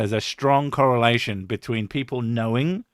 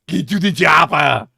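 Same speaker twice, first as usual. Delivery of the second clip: put-on loud Austrian-accented action-hero yell; hammed-up Austrian accent, higher and louder; Arnold Schwarzenegger; 4 semitones higher, 7 dB louder than usual. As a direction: bellow it in a thick Austrian accent. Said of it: "everybody does an Arnold Schwarzenegger impression".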